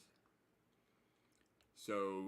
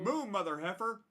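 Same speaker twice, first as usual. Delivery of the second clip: much higher and louder cry; exclaimed; 11 semitones higher, 10 dB louder than usual. Real, not acted. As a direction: bellow it out. Reading gift name out loud.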